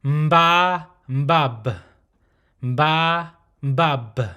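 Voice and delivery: mba with a nasal m onset, then mbab closed on a b. Shown as a demonstration. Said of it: "this consonant is pronounced".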